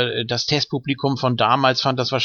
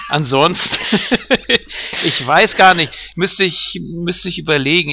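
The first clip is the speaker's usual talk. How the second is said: laughing; 4 semitones higher, 3 dB louder than usual. Real, not acted. Laughs while searching for an answer.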